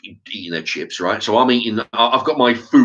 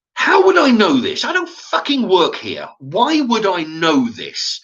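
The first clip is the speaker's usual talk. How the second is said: high, incredulous outburst; indignant retort; 9 semitones higher, 4 dB louder, slower than usual. Retold or imitated. Customer snapping back at the shop worker, indignant. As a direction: throw it back at him, high-pitched and indignant. Acted retort in retold quarrel.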